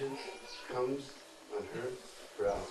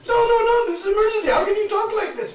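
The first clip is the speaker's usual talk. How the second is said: frantic pleading; 10 dB louder than usual. Acted. Jatila pleads with Radharani.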